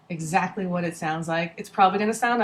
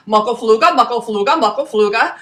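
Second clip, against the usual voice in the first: said three times fast; 9 dB louder than usual. Shown as a demonstration. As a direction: rattle off Muckofluga, Muckofluga, Muckofluga quickly like a tongue twister. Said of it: "Say that three times fast".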